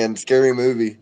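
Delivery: ordinary talk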